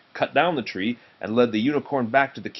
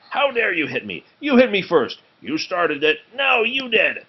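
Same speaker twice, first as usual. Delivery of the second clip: ogre quarrel; 9 semitones higher, 4 dB louder than usual. Acted. Ogres quarrelling.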